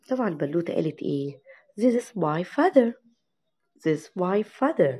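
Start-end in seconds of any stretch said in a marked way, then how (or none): 1.8-4.9 This is my father, very slow and clear; slow model reading. Shown as a demonstration. Teacher reads the girl's line to kids, very slow. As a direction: spell it out slowly for the kids. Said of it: "teacher modeling the dialogue".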